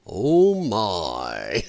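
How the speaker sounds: low, drawn-out Sulu oh my. Shown as a demonstration. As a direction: do Sulu's famous deep oh my. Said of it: "you gotta do it in your Sulu voice".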